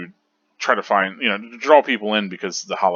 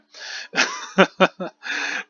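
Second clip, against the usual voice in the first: airy self-mocking laugh; breathy laugh; 12 semitones higher than usual. Real, not acted. Laughing at his own Byakuya pronunciation, airy.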